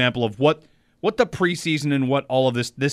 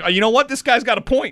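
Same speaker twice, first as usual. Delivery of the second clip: much higher, louder put-on quote; mock social media reply; 9 semitones higher, 4 dB louder than usual. Host voicing the Dolphins tweeting it out, mock agreement. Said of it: "tweet it out and be like".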